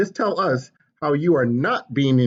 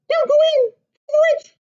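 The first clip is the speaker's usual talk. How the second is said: high, loud, eager chick's squeak; a tiny excited hen butting in; little chicken voice; 6 dB louder than usual. Acted. Chicken bursting into papa's story, high and eager.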